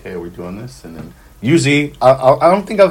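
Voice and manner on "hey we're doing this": a soft, offhand hey to a stranger, much softer; casual quiet greeting. Shown as a demonstration. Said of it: "the way I usually start out is".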